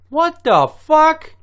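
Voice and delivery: very high, loud, drawn-out cry; mock shock. Unsuspecting aliens see humans land, shrieked mock shock. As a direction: squeal it slowly in disbelief.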